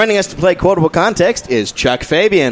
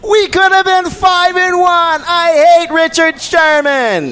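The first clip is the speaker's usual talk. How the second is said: much higher, slow, put-on Atlanta fan accent like a young girl; bad Atlanta accent; 11 semitones higher, slower than usual. Quizmaster reads an upset Falcons fan's quote in a bad, high Atlanta accent. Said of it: "That was the worst Atlanta accent I've ever heard".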